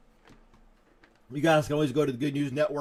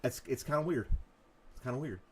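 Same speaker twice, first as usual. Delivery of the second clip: normal talking voice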